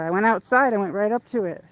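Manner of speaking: usual speaking voice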